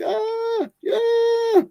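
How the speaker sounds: sudden very high, loud cry of pain; pained yelp. Retold or imitated. Young lion gets his eye clawed out, high yelp. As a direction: yelp like a punched young rival. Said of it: "And the young guy's like".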